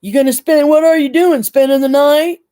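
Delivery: high, loud, pissy holler imitating her. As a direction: holler it up the steps, mocking her irritated demand. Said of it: "actually hollering".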